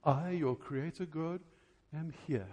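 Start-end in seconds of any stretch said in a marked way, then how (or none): none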